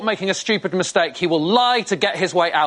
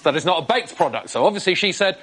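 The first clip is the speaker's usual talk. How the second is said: usual speaking voice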